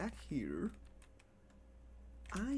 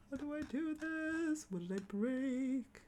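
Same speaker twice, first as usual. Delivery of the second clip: silly voice; 9 semitones higher, slower than usual. Pretend. Confused, in a put-on high voice.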